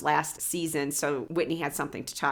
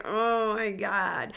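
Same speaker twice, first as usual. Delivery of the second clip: drawn-out, exasperated groan of words; mock dismay, dragging the words out slowly; exasperated reaction; 2 semitones higher, much slower than usual. Host reacting to a reality star, exasperated.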